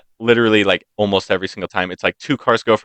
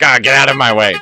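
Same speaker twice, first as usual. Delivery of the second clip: louder, higher mock annoyance; impatient driver; 4 semitones higher, 5 dB louder than usual. Rude driver to whoever is in the road, mock impatient. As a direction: act out an irritated driver.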